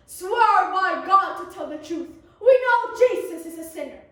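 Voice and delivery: loud hammy accusation; stern demand. Acted. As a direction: demand the oath loudly. Pharisees demand an oath.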